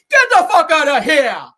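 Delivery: a mock furious kick-out; angry Charles. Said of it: "That was Charles".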